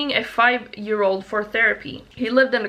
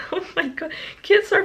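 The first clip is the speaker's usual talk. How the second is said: laughing; 9 semitones higher than usual. Real, not acted. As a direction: laugh it out in disbelief. Laughing at creepy kid story.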